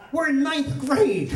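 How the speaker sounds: high, slow mock-outraged protest; exasperated daughter. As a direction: stretch it out as an appalled teenager. Daughter protests to her dad.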